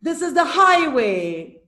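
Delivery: HIGHway, long strong first syllable; first-syllable stress. Shown as a demonstration. Teacher puts the trochee in a sentence, stressing high in highway. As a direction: lean on high in highway, let way fall. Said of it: "the first syllable has stress".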